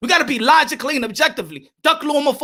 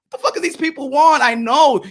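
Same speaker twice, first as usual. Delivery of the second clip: ordinary talk, nothing marked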